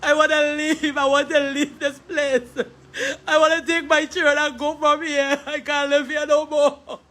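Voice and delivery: choked laugh-cry through the words. Real, not acted. Sister begs to leave the country, laugh-crying. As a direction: sob and half-laugh in despair. Grieving sister wants to flee.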